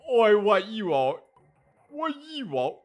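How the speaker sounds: loud, high British "oi" challenge; put-on British accent. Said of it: "what kind of accent this mouth would have".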